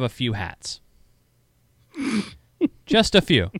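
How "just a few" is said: laughing. Real, not acted. Laughing at own hat collection.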